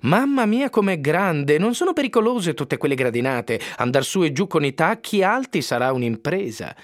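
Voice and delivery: high, mock-naive squeal of wonder; squealing fake dimwit. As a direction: squeal it like a pretend airhead. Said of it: "Ilona strillò da finta tonta".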